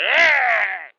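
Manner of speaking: high strained grunt; straining grunt. Retold or imitated. Brother baring his teeth with fists up, high strained grunt. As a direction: grunt like he's straining hard. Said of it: "He's like".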